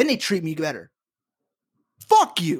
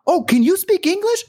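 mocking customer; 10 semitones higher, 3 dB louder than usual. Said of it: "An actual sentence told to me".

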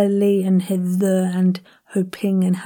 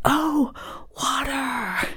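higher, drawn-out American "Oh, water"; mock an American suddenly catching on; exaggerated American realisation; American accent; 5 semitones higher, 4 dB quieter, much slower than usual. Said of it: "And they're like".

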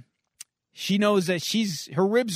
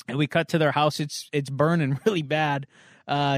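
ordinary talk, nothing marked

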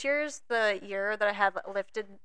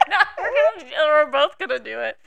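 laughing until near tears; words squeezed out through laughter; laughing hard; 11 semitones higher, 10 dB louder, faster than usual. Real, not acted. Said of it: "Oh, that's too funny".

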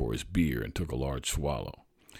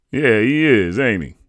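proud, louder, a little higher; 7 semitones higher, 12 dB louder than usual. A narrator's reading. Father answering the bartender about his boy, proudly. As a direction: puff up with pride. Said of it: "my father answered proudly".

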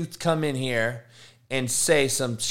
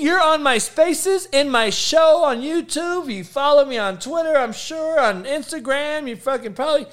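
shouted, pitched way up, mocking a troll; high-pitched rant; 10 semitones higher than usual. Real, not acted. Telling off a troll on his Spaces, shrill mocking yell. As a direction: scream up high, exasperated and contemptuous.

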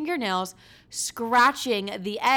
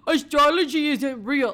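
higher, louder mock skeptic; louder mocking voice of a doubter; mocking skeptic guy; 5 semitones higher, 6 dB louder than usual. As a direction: parody a smug guy dismissing astrology. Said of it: "someone that's like".

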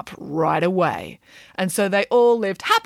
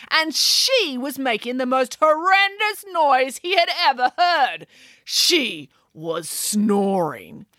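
higher, slower storyteller relish; Nanny Piggins voice; 5 semitones higher, slower than usual. Acted. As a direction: savour the horror of it. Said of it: "She sounded like a chainsaw".

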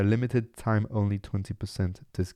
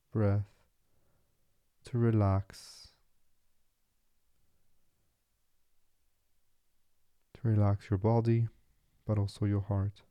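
soft, unhurried meditation voice with long pauses; guided meditation; 4 dB quieter than usual. Acted. Podcast host settles listeners into relaxing body and mind, guided meditation. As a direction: guide them gently into stillness.

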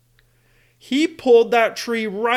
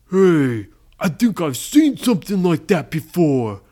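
put-on pirate crew voice; pirate crewman; 4 dB louder than usual. Pirate telling Blackbeard he has seen this before, pirate crewman. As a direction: pipe up as a pirate lackey. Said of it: "the pirate said".

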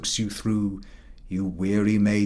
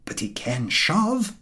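lightly voiced, slow, smug dog; a self-satisfied working dog sizing up his master; proud milk-cart dog; 9 semitones higher, slower than usual. A narrator's reading. Milk-cart dog to himself about his master, a touch smug and slow. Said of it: "What he says to himself is".

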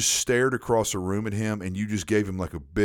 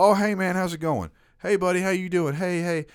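higher, breezy hello to hide a forgotten name; fake-friendly greeting; 8 semitones higher than usual. Speaker demoing a greeting that dodges a forgotten name. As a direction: breeze past the name cheerfully.

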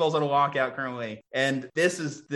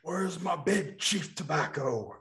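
put-on old man voice; 3 semitones higher, 4 dB quieter, slower than usual. Continuing old man bit.

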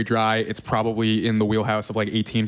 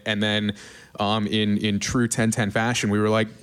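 normal talking voice

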